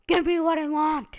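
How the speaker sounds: mock demand. Batista shouting at Triple H, full-voiced yell. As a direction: yell it like a wrestler demanding the title shot. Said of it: "Batista's all like".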